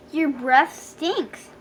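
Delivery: slow, disgusted complaint; complaining. A narrator's reading. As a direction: drag the words out in disgust. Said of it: "she complained".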